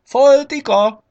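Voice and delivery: high, loud drawn-out name call; big summoning shout. A narrator's reading. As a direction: holler for someone across a crowd. Rabbit calls Foltika from the crowd.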